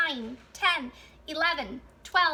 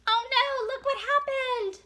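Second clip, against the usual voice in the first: high-pitched playful alarm; dismayed surprise; 10 semitones higher, 3 dB louder than usual. Pretend. Teacher shows children the page where the wagon breaks, dismayed surprise. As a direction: react with big storybook dismay.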